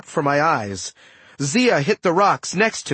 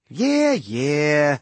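dismissive, drawn-out sighing delivery; weary sigh. A narrator's reading. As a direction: wave off the protest with a sigh. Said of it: "Setna sighed".

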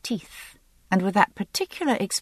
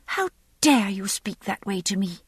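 higher-pitched, haughty outrage; indignant young lady; 4 semitones higher than usual. A narrator's reading. Young lady rebuking her father's groom, indignant. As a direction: take offence as an insulted noblewoman, pitched up. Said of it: "Her face flamed and her eyes narrowed".

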